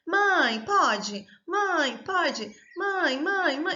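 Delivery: put-on high, loud pleading child tugging at mum; child nagging mother, high and insistent; whiny child. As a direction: pester mum over and over like a small child. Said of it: "puxando a saia da mãe e falando assim".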